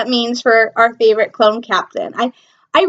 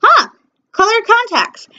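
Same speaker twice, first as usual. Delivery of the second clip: unimpressed shrug; 7 semitones higher than usual. Retold or imitated. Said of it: "I'm just going".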